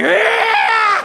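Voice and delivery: sudden high, loud shocked cry; startled yelp. Retold or imitated. Man jolted by a cold foot in bed, loud startled yell. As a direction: jolt at an icy foot. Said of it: "you're like".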